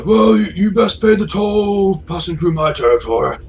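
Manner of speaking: high, loud raider leader voice; high-pitched hollering road bandit chief; wasteland gang boss. Acted. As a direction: pitch it way up, a loud swaggering toll-collector. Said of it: "That's a great voice".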